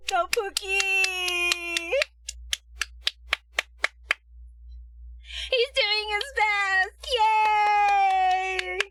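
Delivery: over-the-top supportive wife cheering; mock supportive cheer. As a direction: cheer on your sweetie, hammed up.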